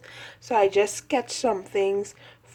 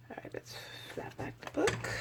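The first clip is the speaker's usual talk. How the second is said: whisper; 9 semitones higher, 14 dB quieter than usual. Real, not acted. Whispering while flipping the sketchbook.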